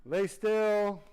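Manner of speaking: commanding shout to patient; loud command. Real, not acted. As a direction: call out firmly so he freezes. Orders patient to stay still.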